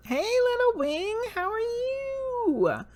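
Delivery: very high, slow sing-song greeting; baby talk. Acted. She greets the little winged figure on the card in high baby talk. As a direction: coo it up high to a little bird.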